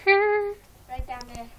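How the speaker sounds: loud, slow outro call; loud shout. Real, not acted. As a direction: call it out slowly to viewers.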